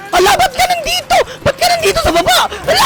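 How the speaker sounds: high-pitched, alarmed outcry; panicked squeal. Real, not acted. Player meeting the enemy downstairs, panicking. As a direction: squeal it in dismay.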